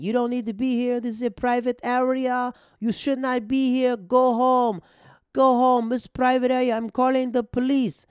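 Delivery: high, loud shrill-free mock shouting from a balcony; drunk heckler impression. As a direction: yell it like a drunk lady on a roof. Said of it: "She's heckling a comedian saying".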